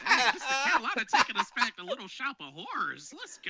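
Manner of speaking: high, loud and laughing all through; delighted laughing. Real, not acted. Host cheering on the guest's roast, laughing.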